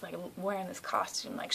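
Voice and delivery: usual speaking voice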